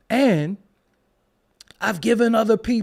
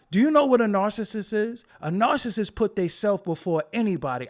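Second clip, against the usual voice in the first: usual speaking voice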